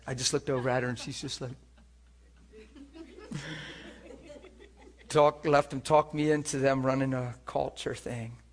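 usual speaking voice

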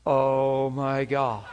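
a slow, stunned "Oh my God" with a laugh; awed, slow. Retold or imitated. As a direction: breathe it out in wonder. Awe as power hits.

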